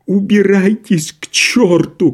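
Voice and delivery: high, drawn-out wailing cry; tearful shout. A narrator's reading. Doctor chases visitors into the hall, tearful shout. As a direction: shout as if close to tears. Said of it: "крикнул он плачущим голосом".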